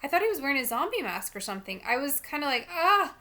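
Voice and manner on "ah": high, loud startled cry; frightened "ah", acted out; scary-movie "ah". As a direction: yelp as if the bad guy jumped out. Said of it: "when you're watching a scary movie".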